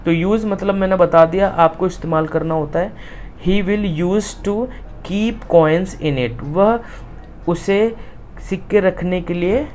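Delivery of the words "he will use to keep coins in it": slow, word-by-word reading; slow reading aloud. Shown as a demonstration. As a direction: dictate it to the class. Teacher rereads textbook line. (धीरे-धीरे पढ़ना)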